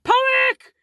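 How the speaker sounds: loud, high-pitched outburst; sudden panicked shout. Retold or imitated. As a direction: blurt it out at full volume. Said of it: "I just shouted".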